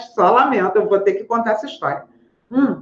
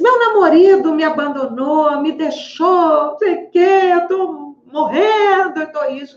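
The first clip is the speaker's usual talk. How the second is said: weepy patient; 6 semitones higher than usual. Retold or imitated. Patient tells therapist her boyfriend left, in a higher weepy voice. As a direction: mimic the crying patient, wailing. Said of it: "Aí ela disse".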